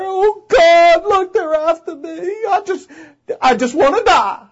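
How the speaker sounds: high, whining self-pitying plea; whiny Elijah. Acted. Preacher voices Elijah whining to God, high and pitiful. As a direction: Elijah whimpering under the bush.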